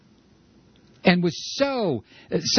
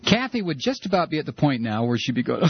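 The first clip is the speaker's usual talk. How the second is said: usual speaking voice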